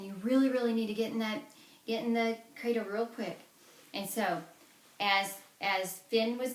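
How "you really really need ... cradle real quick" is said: urgent hurry. Acted. Una hurrying Finn into cradle.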